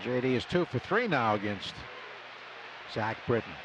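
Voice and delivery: normal talking voice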